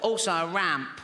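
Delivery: put-on strained child voice; a hint of the comic child voice; funny little voice. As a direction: push the words out like the wheelchair kid. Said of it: "a funny little voice".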